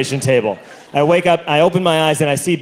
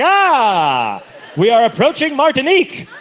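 mock cruise announcer; 6 semitones higher than usual. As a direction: announce it like a ship's captain at the rail. Cruise ship grand announcement.

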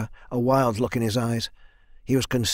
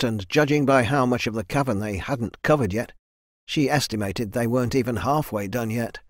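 ordinary delivery

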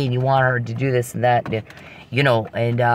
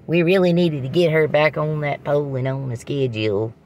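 mocking a country bumpkin, the hillbilly accent pitched up; 4 semitones higher than usual. Mocking the hillbilly men she complains about, in a put-on hillbilly accent. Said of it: "we don't speak hillbilly".

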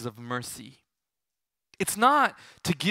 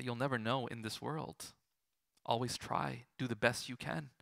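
gentle advice; 4 semitones lower, 11 dB quieter than usual. Retold or imitated. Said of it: "I say".

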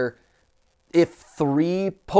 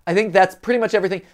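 ordinary talk

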